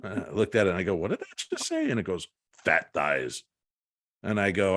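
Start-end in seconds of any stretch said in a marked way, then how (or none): 0.9-1.9 high-pitched mock bewilderment; startled disbelief. Man questioning his ghost gadget, high startled disbelief. Startled reply to the talking device. 2.7-3.4 low mimicked voice of a word box; electronic voice box. Ghost gadget speaking up to its owner, low electronic voice-box impression. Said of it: "an electronic voice box".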